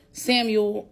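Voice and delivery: usual speaking voice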